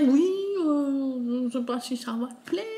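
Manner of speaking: mock-hesitant friend, slightly high; lightly put-on voice of an unsure gift giver; imitating a hesitant friend. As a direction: imitate her doubting the gift. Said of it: "Elle osait me dire".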